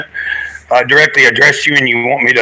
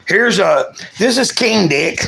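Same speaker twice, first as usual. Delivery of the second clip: ordinary talk, nothing marked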